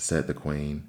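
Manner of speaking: plain reading voice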